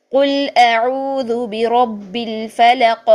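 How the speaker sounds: very slow, drawn-out Quran recitation. Shown as a demonstration. Teacher reciting Surah Falaq as an example. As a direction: recite it long, bouncing the final ق. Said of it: "یہ دیکھیں".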